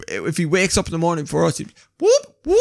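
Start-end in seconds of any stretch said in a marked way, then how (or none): 2.0-2.6 very high rising whoop; imitated dog noise. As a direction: mimic a dog's attention-seeking whoop. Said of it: "the noise that the dog makes".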